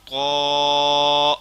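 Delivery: طا heavy with a full mouth. Shown as a demonstration. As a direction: fill the mouth for طا. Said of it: "منہ کو پر کر کے پڑھنا ہے".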